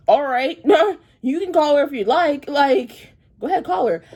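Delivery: loud, high mocking dare to call the owner; sassy challenge daring the stylist to call the owner; defiant dare. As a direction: go on, call her, I dare you. Defiant reply in argument.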